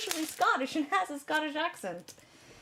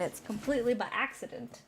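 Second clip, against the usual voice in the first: usual speaking voice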